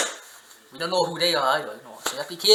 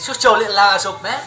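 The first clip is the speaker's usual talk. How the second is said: put-on louder, half-joking scold of a crying baby; mock yell at baby; 5 semitones higher, 8 dB louder than usual. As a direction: bark it at the ghost baby like a fed-up parent. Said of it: "I said".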